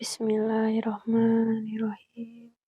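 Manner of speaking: slow reverent whisper; whispered prayer. Real, not acted. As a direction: breathe the prayer out slowly. Whispered prayer before entering.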